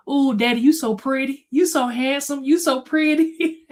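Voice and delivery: put-on butter dad up like a cute child; sweet-talking little kid. Boy flattering his dad to dodge a whooping, cooing kid voice. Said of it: "And then I said".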